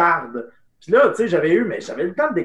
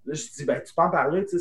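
usual speaking voice

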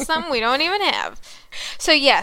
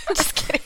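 motorboat impression; 4 semitones higher than usual. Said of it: "It's my motorboat impression".

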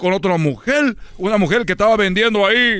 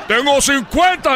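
dad's voice; 6 semitones higher, slower than usual. Retold or imitated. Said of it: "en la voz de mi papá".

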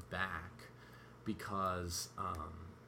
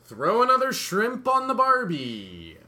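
put-on loud, high, slow Australian accent; 12 semitones higher, 13 dB louder than usual. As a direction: drawl it like a hammy Aussie ad. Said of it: "With our accents from America".

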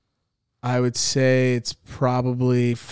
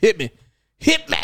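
rising each time; 14 semitones higher than usual. Pretend. Repeated call, rising each time.

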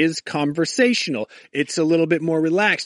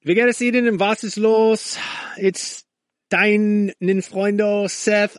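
higher-pitched learner's German; showing off German; 4 semitones higher, slower than usual. Shown as a demonstration. Host showing off his beginner German to listeners, eager-to-impress German. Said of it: "trying to impress you with the little German that I know".

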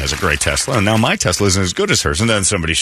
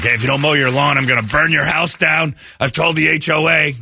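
higher, pushed threat; mock angry neighbor; 8 semitones higher than usual. Host imitating bullying neighbor's threat, mock angry. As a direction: play the petty neighbor threatening.

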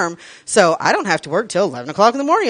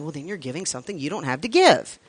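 normal talking voice

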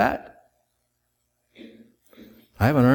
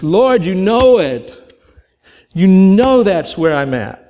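grieved plea; 5 semitones higher, 6 dB louder, slower than usual. Acted. Peter answers Jesus, grieved.

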